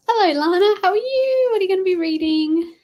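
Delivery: high bright hello to a viewer; chirpy upbeat greeting; sing-song greeting. Real, not acted.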